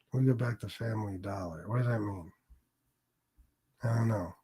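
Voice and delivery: soft, puzzled reread under his breath; quiet. Real, not acted. Rereading a viewer's comment, softly puzzled. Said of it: "let me read some comments".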